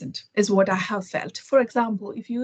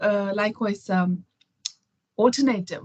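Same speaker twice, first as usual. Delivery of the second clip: ordinary delivery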